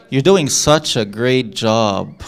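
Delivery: slow, dry mock praise; sarcastic. Shown as a demonstration. Teacher turning praise into slow sarcasm. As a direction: drag out the praise so it means the opposite. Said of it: "Could that be done in a sarcastic way?".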